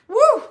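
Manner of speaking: loud, high "woo"; a celebratory woo, thrown up high; excited whoop. Real, not acted. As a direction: let out a high, delighted whoop. Thrilled the spirit came through.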